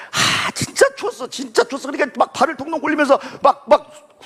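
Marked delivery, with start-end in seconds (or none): 0.1-1.9 exclaiming the cold. Real, not acted. Remembering cold winter.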